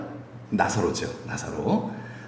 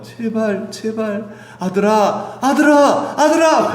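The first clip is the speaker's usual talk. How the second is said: much higher, louder rising cry; desperate pleading; 10 semitones higher, 4 dB louder, much slower than usual. Acted. Parent begging a son to listen, rising to a cry. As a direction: beg your son, louder each time. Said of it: "이렇게 막 나오잖아요".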